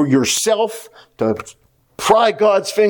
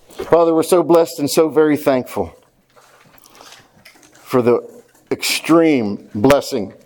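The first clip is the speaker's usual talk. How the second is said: prayer; 2 semitones lower than usual. Acted. Pastor praying for the church, hushed prayer.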